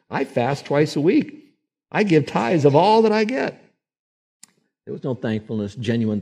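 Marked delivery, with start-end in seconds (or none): 0.1-3.6 slow then high, loud smug boast; self-righteous preening prayer; boastful Pharisee. Retold or imitated. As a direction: brag piously to God.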